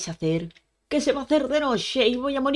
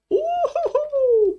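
high, slow put-on chuckle; a goofy hooting laugh; silly high giggle; 4 dB louder, much slower than usual. Real, not acted. Risa tras emocionarse con ovejas. (risita aguda y burlona)

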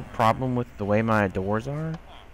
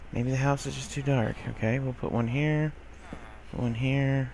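ordinary talk

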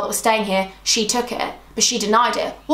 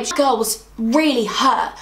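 ordinary talk, nothing marked

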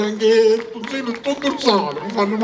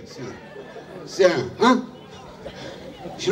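ordinary talk, nothing marked

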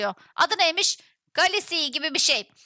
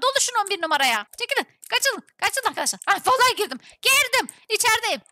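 ordinary talk

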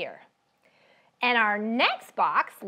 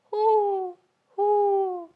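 higher hooting owl; 9 semitones higher than usual. Shown as a demonstration. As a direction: hoot like an owl. Imitating owl call.

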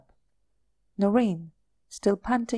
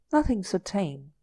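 ordinary delivery, nothing marked

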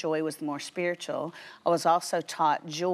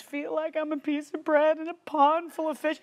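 whiny; 10 semitones higher than usual. Retold or imitated. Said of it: "you talk about whiny".

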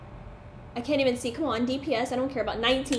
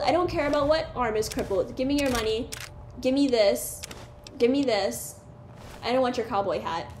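ordinary talk, nothing marked

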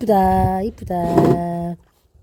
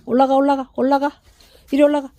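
higher coaxing for a pet; talking to a dog; 7 semitones higher, faster than usual. Real, not acted. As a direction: urge the puppy up.

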